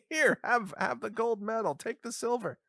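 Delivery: high-pitched mock medal presenter; lightly acted, high announcer. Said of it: "the announcer at the end of a swim meet".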